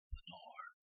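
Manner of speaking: an unvoiced whisper of a name; a hushed, secret whisper of Lenore. A narrator's reading. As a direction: breathe the name out, barely audible. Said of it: "the whispered word".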